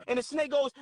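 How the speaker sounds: usual speaking voice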